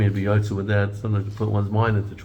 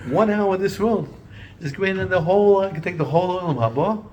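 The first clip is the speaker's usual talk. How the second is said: rising excitement; 10 semitones higher than usual. Real, not acted. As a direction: build the wonder of one hour. Stresses the worth of this world.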